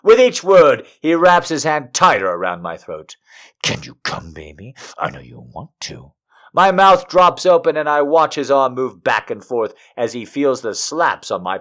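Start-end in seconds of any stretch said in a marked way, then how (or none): 3.6-6.1 low, soft, coaxing murmur; low coaxing lover. Acted. Lover urges her on, low coaxing murmur. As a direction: coax her gently and low.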